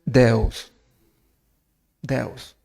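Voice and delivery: Deus said the Portuguese way, de-us. Shown as a demonstration. Preacher showing the congregation Portuguese Deus. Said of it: "En portugués".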